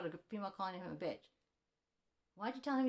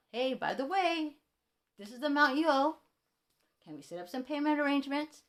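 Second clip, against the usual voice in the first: polite request; 5 semitones higher, 5 dB louder than usual. Retold or imitated.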